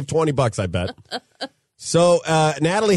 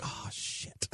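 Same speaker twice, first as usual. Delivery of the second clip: whispered curse; 22 dB quieter than usual. Retold or imitated. Dread at first drop.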